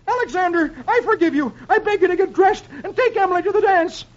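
very high, comic begging voice; pleading father. A narrator's reading. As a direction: beg your son desperately in falsetto. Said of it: "drops on his knee outside of Alexander's room".

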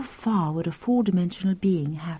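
hushed unvoiced whisper. A narrator's reading. Narrator whispering to the listener. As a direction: breathe it like a secret thought.

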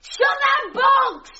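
high, drawn-out yelling; frantic shouted order. Acted. Wife screaming at husband to shut box, frantic yell. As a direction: yell slowly over a roaring gale. Shouting over rushing wind.

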